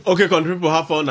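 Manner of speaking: radio greeting. Acted. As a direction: hype the listeners. Co-host greets listeners.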